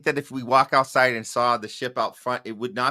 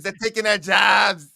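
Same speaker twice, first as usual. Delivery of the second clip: mock protest shout; 7 semitones higher, 7 dB louder than usual.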